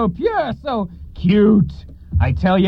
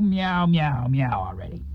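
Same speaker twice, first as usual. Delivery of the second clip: grumbling meows; 8 semitones lower, slower than usual. Acted.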